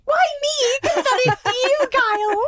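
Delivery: high squeal. Real, not acted. Squealing in mock panic.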